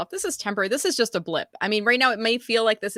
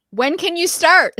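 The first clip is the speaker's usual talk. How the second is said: high, loud, slow, playful interviewer voice; mock interviewer; 9 semitones higher, 9 dB louder, slower than usual. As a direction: act the eager interviewer. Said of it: "answering best interview question".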